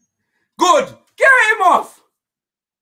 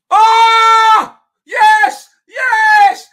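loud, drawn-out roar; excited shouting; 4 semitones higher, 8 dB louder, much slower than usual. Real, not acted. Fan roaring as the shot hits the post, excited shout. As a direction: roar it at the top of your voice. Fan reacts to near goal.

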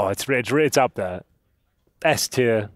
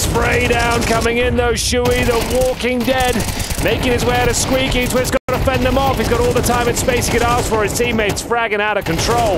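live esports play-by-play; 9 semitones higher, 4 dB louder than usual. Real, not acted. Caster calls Shui's big spray to the viewers, excited play-by-play. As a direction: call the action high and sustained.

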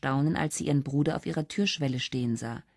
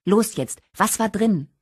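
higher, louder demand; impatient urging; 6 semitones higher, 5 dB louder than usual. A narrator's reading. Sharon demanding to know the card's contents, impatient. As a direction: press her eagerly for the answer.

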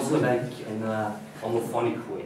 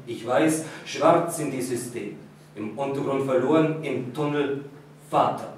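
measured, even poetry recital; poem reading; 2 semitones higher, 5 dB louder than usual. Acted. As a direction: read the verse with care, line by line. Reads the German poem aloud.

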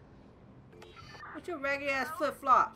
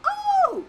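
high, loud yelp; sudden high hoot of shock; startled whoop; 9 dB louder than usual. Real, not acted. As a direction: cry out, startled. Startled in game.

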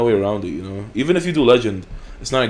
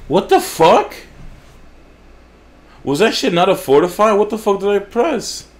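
high, loud, furious outburst; angry gamer yelling at the screen; raging yell; 9 semitones higher, 6 dB louder than usual. Real, not acted. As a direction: shout it at the game in disbelief. Raging at wrong click.

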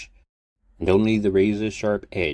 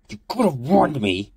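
angry hiss, louder and higher; 8 semitones higher, 5 dB louder than usual. Acted. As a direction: hiss it in fury. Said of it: "he hissed".

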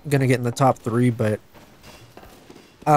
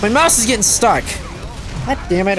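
frustrated cry; 7 semitones higher than usual. Real, not acted. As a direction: cry out at the stuck mouse. Mouse freezes mid-game.

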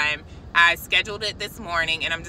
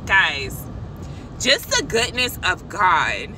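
getting emotional; 2 semitones lower than usual. Real, not acted. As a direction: let real emotion catch you mid-sentence. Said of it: "I don't know why I'm getting emotional".

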